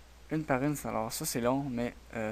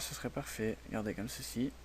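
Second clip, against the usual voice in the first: softer, half to himself as he clicks; speaking softly; 9 dB quieter than usual. Real, not acted. Tutorial host explaining the cylinder tool to viewers, quiet. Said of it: "Désolé si je parle pas fort".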